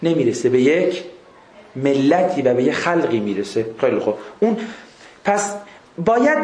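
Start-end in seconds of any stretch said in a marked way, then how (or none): none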